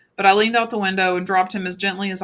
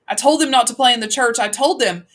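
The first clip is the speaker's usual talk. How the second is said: panicked and raised, she blames herself; frantic mother; 4 semitones higher, 7 dB louder than usual. A narrator's reading. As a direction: voice climbing in alarm, the kids are inside. Frantic mother fearing for kids.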